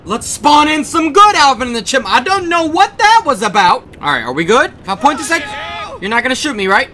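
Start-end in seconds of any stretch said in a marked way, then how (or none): none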